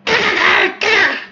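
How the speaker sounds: short high squeals; mock falsetto shrieks; imitated attack cries. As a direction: squeal with each knife lunge. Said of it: "she went".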